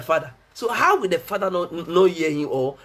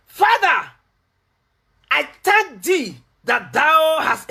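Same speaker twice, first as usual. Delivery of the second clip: slow, drawn-out, higher preacher's reading; declaimed scripture reading; 6 semitones higher, 4 dB louder, much slower than usual. Real, not acted. Preacher reading the raising of Lazarus to the congregation, slow declamation. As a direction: proclaim the verse with long, weighty words.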